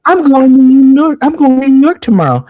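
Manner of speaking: mocking the heifer. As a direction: ham up her bragging announcement in a high voice. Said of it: "the first thing the heifer said was".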